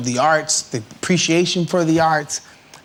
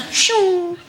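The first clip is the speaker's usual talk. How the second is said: very high, drawn-out whoosh; imitated zoom. Shown as a demonstration. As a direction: make a long high sound of speeding past. Imitates zooming past, "like.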